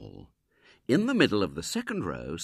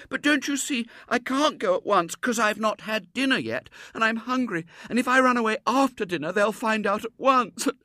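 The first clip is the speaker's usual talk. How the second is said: put-on high, loud child voice; fretful little girl; 8 dB louder than usual. A narrator's reading. Girl objecting to William's plan, high fretful child. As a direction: protest in a high, girlish rush.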